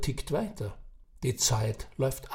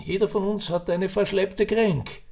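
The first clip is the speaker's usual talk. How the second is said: put-on raised old Viennese voice, Kränk in passing; old man's saying; 6 semitones higher than usual. Old man to Ferdinand, casual Viennese wisdom. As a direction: toss off verschleppte Kränk as common wisdom.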